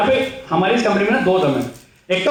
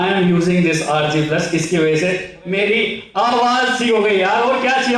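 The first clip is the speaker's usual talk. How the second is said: slow, proud explanation; slower than usual. Retold or imitated. Telling the doctor about the product.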